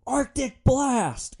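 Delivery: very high, loud, drawn-out yell; screamed battle cry. Retold or imitated. Driver unleashes freezing air on brawling kids, screaming. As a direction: bellow it like a superhero move. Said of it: "And I scream".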